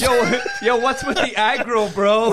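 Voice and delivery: mock bro voice. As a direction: toss off a teasing bro yo. Mocking the aggressive bartender.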